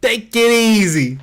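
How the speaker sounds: put-on Italian-American mobster accent. Streamer imitating the game character's New York Italian accent. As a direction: lean into the New York wise-guy accent. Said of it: "I like that they actually give him this accent".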